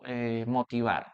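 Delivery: usual speaking voice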